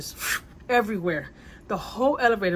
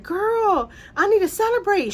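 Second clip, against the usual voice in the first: much higher and louder, a squealing greeting; mock drunk screaming; 13 semitones higher, 7 dB louder, slower than usual. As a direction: squeal it like a tipsy friend spotting you. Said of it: "she starts screaming and she's like".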